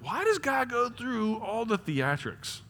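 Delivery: high, drawn-out wondering; puzzled questioning. Retold or imitated. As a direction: ask it high and slow, baffled. Acts out own puzzled question.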